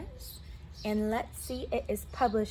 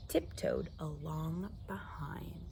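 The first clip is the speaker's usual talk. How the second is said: slow whispered narration; sneaky whisper; 5 semitones lower, 9 dB quieter, slower than usual. A narrator's reading. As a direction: whisper like you are tiptoeing. Coyote sneaks after pig.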